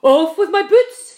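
high, loud yell; panicked shout. A narrator's reading. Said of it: "shouted the centipede".

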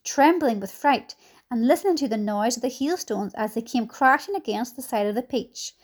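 ordinary delivery

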